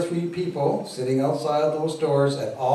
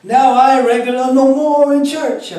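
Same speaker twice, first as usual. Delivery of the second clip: high, loud Jamaican Rasta storytelling voice; Rastafari accent; 11 semitones higher, 10 dB louder than usual. Acted. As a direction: win her over in Rasta voice. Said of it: "my Rastafari accent".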